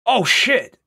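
loud, high exclamation; startled. Real, not acted. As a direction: blurt it out in surprise. Sudden surprise in the game.

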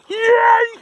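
high, loud yay; excited cheer. Real, not acted. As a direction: cheer the dog on. Cheering dog fetching ball.

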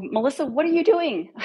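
high, urgent call of her name; exasperated confrontation; sharp demand. Retold or imitated. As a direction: demand an answer, rising pitch. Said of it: "That's how I said it".

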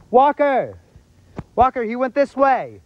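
loud urgent shout; calling out. Real, not acted. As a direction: call him over, loud. Calling out to friend.

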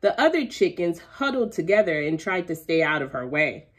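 usual speaking voice

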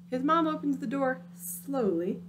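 ordinary delivery, nothing marked

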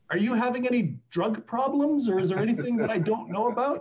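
high-pitched imitation of maternal suspicion; worried mother. Mother asking her son about drug problems, suspicious. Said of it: "of course, she also says".

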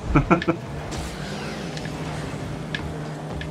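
soft airy rising laugh; quick breathy laugh at the screen. Real, not acted. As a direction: let out a quiet breathy chuckle. Laughs at a play.

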